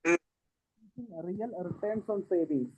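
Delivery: faint, far from the mic; barely audible. Real, not acted. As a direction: voice fading away from the mic. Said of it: "why your voice is very low".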